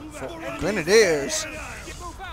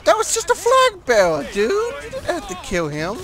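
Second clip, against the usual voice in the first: mock protest; 12 semitones higher than usual. Player protests to friend over the killed flag bearer, high mock protest. Protesting a kill during the game.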